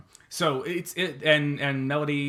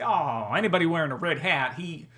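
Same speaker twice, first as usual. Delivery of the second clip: put-on Southern accent; 5 semitones higher than usual. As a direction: put on a higher Southern accent for a gullible local. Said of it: "Why are they always Southern?".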